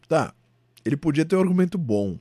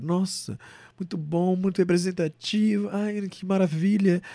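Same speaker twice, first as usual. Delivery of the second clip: gushing fan voice; 7 semitones higher than usual. Retold or imitated. Imitating gushing Instagram fans.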